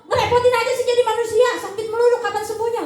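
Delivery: sarcastic partner grumbling at the sick one; annoyed spouse. Retold or imitated. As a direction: act out a fed-up spouse complaining. Uncaring spouse complaining.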